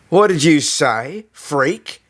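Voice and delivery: a bully squaring up, menacing. A narrator's reading. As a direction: dare him to repeat it. Bully confronts the narrator.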